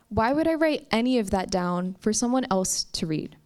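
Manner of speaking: plain reading voice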